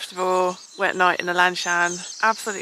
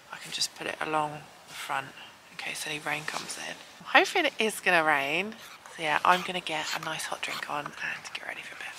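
whispering; 4 dB quieter than usual. Real, not acted. Whispering in tent at night.

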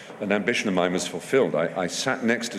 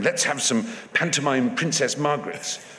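higher-pitched eager suggestion; Terry Jones and Michael Palin; 6 semitones higher than usual. Acted. Imagined Python meeting of the lovable pair, eager mock suggestion. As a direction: pitch it up as a lovable troupe member. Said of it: "Terry Jones and Michael Palin saying".

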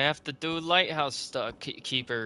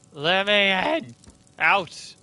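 loud, high, drawn-out holler; mock panicked shout; 7 semitones higher, 9 dB louder, slower than usual. Player to the game, trying to get through, mock shouting.

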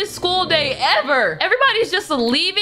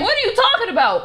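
ordinary talk

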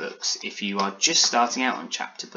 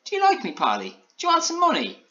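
needy gingerbread man; 3 dB louder than usual. A narrator's reading. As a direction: pitch leaping very high. Alan needily offers Polly money.

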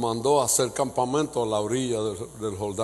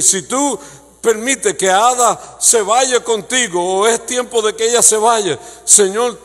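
louder, higher preaching cry; full-force preaching; 8 semitones higher, 8 dB louder than usual. Acted. Pastor preaching hard to the congregation, full force. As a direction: preach it out with everything.

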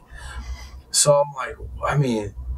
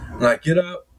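normal talking voice

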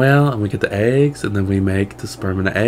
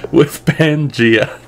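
laughing; 3 semitones higher, much slower than usual. Real, not acted. Laughs at crafting Japan.

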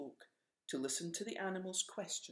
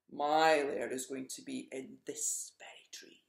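angry dragon; 6 dB louder than usual. Acted. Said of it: "roared the dragon".

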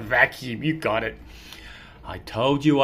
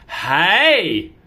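usual speaking voice

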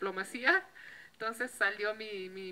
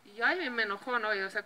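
ordinary talk

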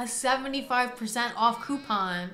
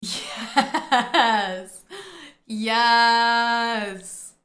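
excited yes; 7 dB louder than usual. Real, not acted. Thrilled by the coupon deal.

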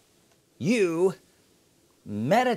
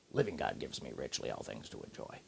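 barely audible low, rapid mutter; 14 dB quieter, faster than usual. Shown as a demonstration. Preacher demonstrating muttering of God's word, under the breath. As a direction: mumble it quickly to yourself, very low. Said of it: "you say something under your breath".

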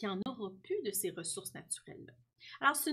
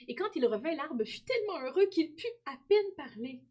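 excited narration; 6 semitones higher, 5 dB louder than usual. A narrator's reading. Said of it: "excited narration".

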